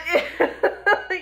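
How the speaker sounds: short high laugh; laughing at the left-field joke. Real, not acted. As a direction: laugh it off lightly. Laughing mid-sermon.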